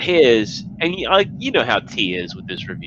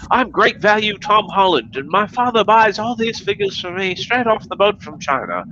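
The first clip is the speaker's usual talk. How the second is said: put-on high whiny spoiled-kid voice; mocking impression; 9 semitones higher than usual. High mocking voice imitating a young reviewer, spoiled kid. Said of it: "he's like".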